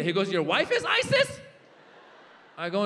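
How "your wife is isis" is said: very high squealed question; freaking out. Acted. Little boy hearing about the comic's wife, freaking out. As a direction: shriek it like a panicked kid. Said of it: "he starts freaking out".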